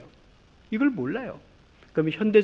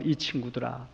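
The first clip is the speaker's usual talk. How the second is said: gentle master; 5 semitones lower, 8 dB quieter than usual. Acted. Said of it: "주인이신 하나님이 뭐라고요?".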